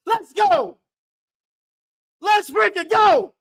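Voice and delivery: high, loud celebration yell; hype shout. Real, not acted. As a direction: roar it at the screen. Celebrating touchdown.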